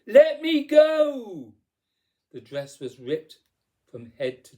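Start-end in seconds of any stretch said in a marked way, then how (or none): 0.1-1.5 high, drawn-out, full-force yell; loud stretched-out demand; shouting. A narrator's reading. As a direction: shout it to break free. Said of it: "As Cindy shouted".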